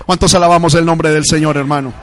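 booming call to praise; rallying shout. Real, not acted. Preacher asks the church who praises the Lord, rallying shout. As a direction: shout it out to the whole congregation.

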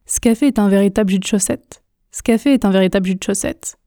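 fast, contracted casual French; express speed. Shown as a demonstration. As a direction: rattle it off quickly, swallowing the schwas. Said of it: "pratiquons à la vitesse express, la vitesse rapide". (vitesse express, rapide et contracté)

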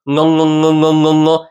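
repeated ng hummed on an even pitch; back-of-mouth ng. Shown as a demonstration. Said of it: "in the back of the mouth".

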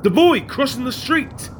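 panicked. Acted. Insisting about the boy.

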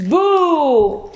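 loud falling ghostly boo. Acted. Isabel copies the principal with a ghost boo. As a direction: copy the scary boo. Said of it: "η Ιζαμπέλ τον αντέγραψε".

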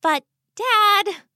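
high daughterly appeal to father; patient protest. Acted. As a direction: reason with papa in a high voice. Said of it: "Sakura said patiently".

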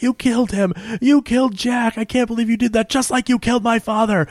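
high-pitched mock distress; retold accusation. Jenny to her father's killer over Jack, high-pitched accusing.

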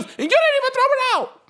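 very high, loud mock squawk; mimicked outcry. Retelling how she scolded the man over the cigarette, mimicked outcry. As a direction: imitate an indignant screech.

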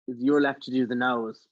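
ordinary talk, nothing marked

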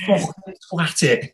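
high-pitched, drawn-out Scouse yell; Steve Redmond. Retold or imitated. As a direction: imitate a Scouse team-mate yelling, slow and high. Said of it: "Steve Redmond shouting his Scouse accent".

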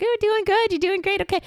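high, sing-song praise for a little one; cheery toddler praise. Retold or imitated. Mom quickly praising her toddler, high and sing-song. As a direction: gush brightly at the small child. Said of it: "Be like".

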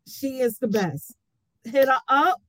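ordinary delivery

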